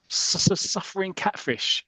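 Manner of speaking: slow, high stuttering su-, su-, suffering catfish; stammered exclamation. Retold or imitated. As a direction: splutter out the oath in shock. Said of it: "Jeff comes out with the".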